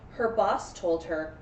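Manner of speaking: normal talking voice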